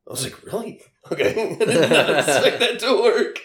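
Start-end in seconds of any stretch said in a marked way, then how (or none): 0.4-1.3 incredulous high really; surprised. Retold or imitated. Telling the bouncer story, surprised. 1.3-3.4 laughing through the words; giggling as he tells it. Real, not acted. Recalling the bouncer trick, laughing.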